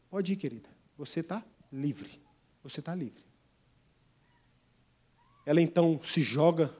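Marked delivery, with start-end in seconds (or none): 0.1-0.7 tender release. Acted. Man releasing the slave woman, softly and tenderly. 1.0-2.1 gentle first telling. Acted. First of repeated line. 2.6-3.2 softer repeat. Acted.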